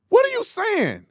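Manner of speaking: very high-pitched incredulous question; exasperated. Real, not acted. Host challenging the reporter, exasperated. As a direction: demand it in high-pitched disbelief.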